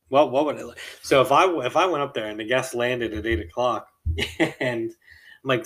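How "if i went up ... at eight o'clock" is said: normal talking voice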